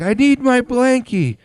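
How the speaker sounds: put-on half-asleep kid insisting on his blankie; toddler demanding blankie. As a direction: whine it like a sleepy little boy. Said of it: "he's like".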